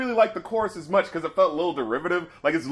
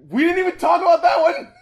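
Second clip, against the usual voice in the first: high, loud realization; excited shout; 10 semitones higher, 4 dB louder than usual. Real, not acted. Cohost remembering a skipped song, excited shout. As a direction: blurt it out, thrilled.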